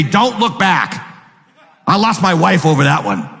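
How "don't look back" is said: slow Lot. Acted. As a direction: let Lot plead it slowly. There's Lot saying.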